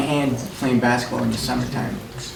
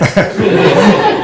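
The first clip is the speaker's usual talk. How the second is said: loud high laugh bursting out; can't hold back the laugh; burst of laughter; 6 semitones higher, 9 dB louder than usual. Real, not acted. Remembering Ida's jerky driving, loud laugh.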